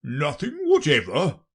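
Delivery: insistent royal voice, rising at the end; persistent king. Acted. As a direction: press the question as a king. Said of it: "persisted the king".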